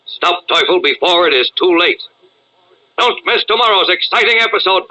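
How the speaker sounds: usual speaking voice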